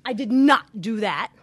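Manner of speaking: put-on loud, high childish denial; mock indignant nuh-uh; defensive denial. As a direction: snap back like a defensive kid. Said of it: "instead of when your spouse gets upset".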